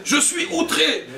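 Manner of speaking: high, drawn-out mock outrage; hammed-up slow indignant protest; acted indignation. Acted complaint, repeated for comedy.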